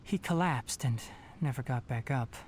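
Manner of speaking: usual speaking voice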